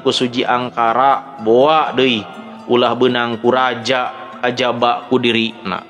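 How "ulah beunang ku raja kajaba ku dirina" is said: ordinary delivery, nothing marked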